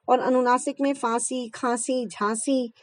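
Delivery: usual speaking voice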